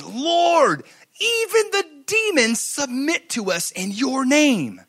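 high, drawn-out wonder; joyful amazement. Acted. Disciples report back to the Lord, acted high-pitched joy. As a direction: act out overjoyed astonishment. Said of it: "returned with joy and said".

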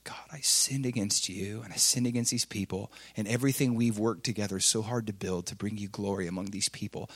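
soft, low confession fading to a whisper; ashamed prayer. Retold or imitated. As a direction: pray it broken and sorry on your knees. Kneeling prayer of repentance.

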